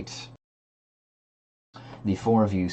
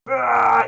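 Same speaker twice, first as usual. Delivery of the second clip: straining cry; 9 dB louder than usual. Retold or imitated. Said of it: "you're like".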